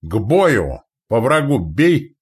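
outraged shouted order; roaring battle cry. A narrator's reading. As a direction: roar the crew into the attack. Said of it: "взревел Шумилович".

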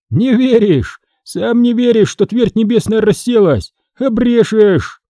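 high-pitched, acted taunt; teasing retort. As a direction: taunt the frightened apprentice. Фома дразнит испуганного подмастерья. (задорно поддразнивая, высоким голосом)

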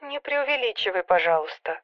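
soft whine over the phone; sister's whiny telephone voice; whining. A narrator's reading. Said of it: "заныла сестра".

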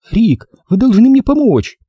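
high-pitched desperate female plea; woman pleading near hysteria; hysterical pleading. Acted. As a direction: beg for help in a high, frantic voice. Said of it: "Голос молил на истерической ноте".

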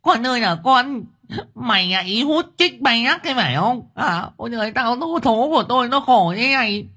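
mother howling at her son in hospital; howling lament. A narrator's reading. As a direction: wail it out in despair. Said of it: "Liên Ngọng nó lại gào lên".

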